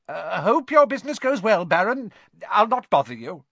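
high, loud, eager farewell; obsequious. A narrator's reading. Peeves leaving the Baron, obsequious. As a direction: fawn while backing away, pitch up.

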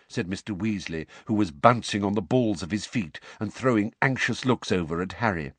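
ordinary delivery, nothing marked

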